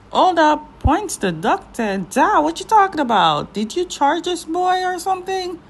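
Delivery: high-pitched playful call-out; mock indignation. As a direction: challenge him in mock disbelief, pitch jumping up. Mock scolding of a drama character.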